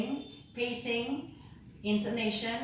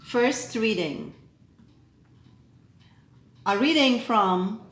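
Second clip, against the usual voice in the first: measured, solemn proclamation with deliberate pauses; lector reading scripture; 3 semitones higher than usual. Acted. Lector proclaiming the readings to the assembly, solemn.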